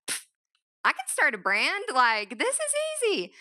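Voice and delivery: scoffing, high and confident; cocky. Retold or imitated. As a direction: brush it off like it's nothing. Cocky retold thought after reading.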